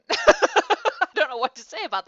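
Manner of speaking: real laughter spilling into speech; bewildered, laughing reaction. Real, not acted. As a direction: talk through helpless laughter, high and loud.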